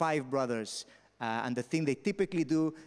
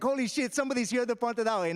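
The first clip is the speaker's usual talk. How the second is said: high, louder surprised exclamation; shocked; 8 semitones higher, 3 dB louder than usual. Retold or imitated. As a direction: gasp at a fellow Pontardawe local. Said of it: "I was like".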